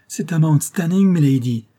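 high, loud exclamation; alarmed cry. A narrator's reading. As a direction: cry it out to your mistress. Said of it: "s'écria Phoebe Marks".

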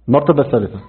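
ordinary delivery

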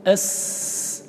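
long voiceless hiss on the س of أس; flowing seen demo. Shown as a demonstration. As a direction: stretch أس into a steady hiss. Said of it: "السين كيف هي؟".

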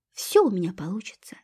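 soft unvoiced whisper. A narrator's reading. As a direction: breathe it quietly to yourself. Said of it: "тихо сказал Карл Антонович".

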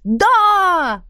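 high, loud cheering yell; crowd's shout. A narrator's reading. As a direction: shout back like an eager crowd. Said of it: "хором закричали гости". (восторженный громкий крик)

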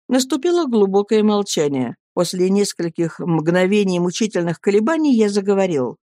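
ordinary delivery

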